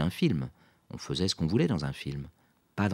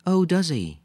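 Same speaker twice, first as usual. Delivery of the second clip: politely interested, higher and louder; a polite, raised-pitch query; 13 semitones higher, 8 dB louder, slower than usual. A narrator's reading. Lisa answers Quentin's mother, politely. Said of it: "demanda Lisa poliment".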